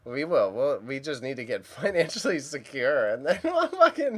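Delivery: talking through laughter; laughing. Real, not acted. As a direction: laugh as you say it. Laughing while speaking.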